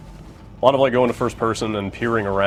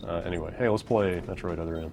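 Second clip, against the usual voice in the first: ordinary talk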